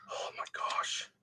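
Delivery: breathy exasperated whisper. Real, not acted. Builder whispering to himself over a stubborn piece. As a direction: whisper it under your breath.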